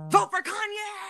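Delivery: high, drawn-out mocking chant; mock fickle fans. Mocking fans who cancel then back [name], high mock cheer. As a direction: parody a fan's campaign cry. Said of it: "y'all will say y'all cancel somebody".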